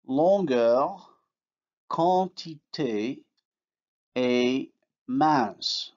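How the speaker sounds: longueur, quantité, mince with nasal vowels, n absorbed; French nasal vowels. Shown as a demonstration. Teacher giving French words with nasal vowels. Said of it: "in words like".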